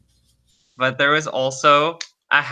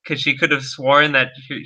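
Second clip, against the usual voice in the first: ordinary talk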